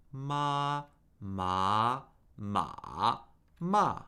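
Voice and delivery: four-tone drill on "ma"; four Mandarin tones. Shown as a demonstration. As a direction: model "ma" in four tones to be repeated. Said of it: "repeat after me".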